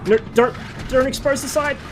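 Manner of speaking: desperate high shout; frantic retreat order. Real, not acted. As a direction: scream the warning in real panic. Tank spotted, panicked crew order.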